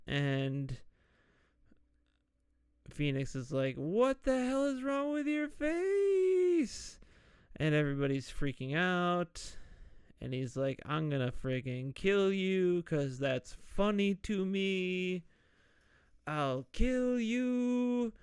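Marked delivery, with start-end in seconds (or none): 3.8-7.0 high, slow horrified shriek; shocked Phoenix. Retold or imitated. Phoenix shocked at Swan's face. 10.8-18.1 gleefully menacing villain voice, slowing at the end; mock villain threat. Swan threatening the Phantom.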